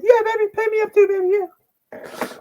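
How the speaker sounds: very high, loud squawking impression; mock wrestler voice. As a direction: squeal it out in a strained put-on voice. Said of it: "He said".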